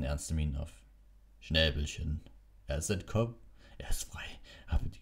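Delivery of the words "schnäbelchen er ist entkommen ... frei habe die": whisper. Acted. Hagrid whispers his happy news.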